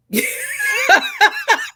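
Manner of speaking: high, shouted burst of laughter. Real, not acted. Reader cracks up at listener question.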